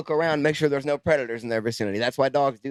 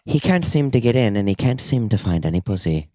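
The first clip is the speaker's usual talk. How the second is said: nature documentary; 6 semitones lower than usual. Pretend. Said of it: "National Geographic film filming fuckboys".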